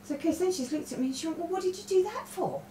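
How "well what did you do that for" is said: higher, lightly voiced daughter asking why; daughter's exasperation. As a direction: echo the daughter's mock disbelief. Daughter reacting to deleted files.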